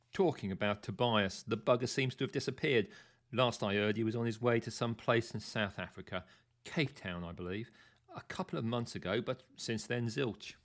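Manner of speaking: usual speaking voice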